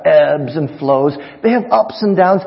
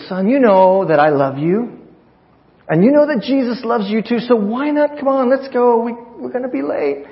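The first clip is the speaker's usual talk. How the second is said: diplomatic coaxing; 8 semitones higher than usual. Retold or imitated. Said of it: "a little bit more diplomatic".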